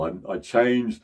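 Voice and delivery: normal talking voice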